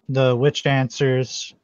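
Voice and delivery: ordinary talk, nothing marked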